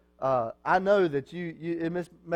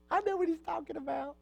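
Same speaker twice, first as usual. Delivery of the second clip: higher, softer mock fangirl voice; mock teen girl; 9 semitones higher, 6 dB quieter, faster than usual. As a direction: playfully imitate a girl who gets the reference. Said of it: "some of the girls are like".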